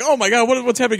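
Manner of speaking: high-pitched, flustered retold cry; mock alarm.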